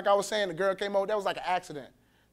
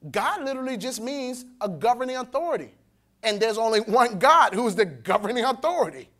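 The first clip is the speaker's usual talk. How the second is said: ordinary delivery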